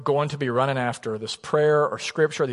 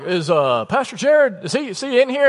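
a higher mock questioning voice; asking for the pastor; 10 semitones higher, 5 dB louder than usual. Man at church asking the wife where Pastor Jared is, higher inquiring voice. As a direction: play the visitor looking for the pastor. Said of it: "he's like".

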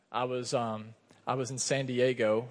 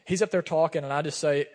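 usual speaking voice

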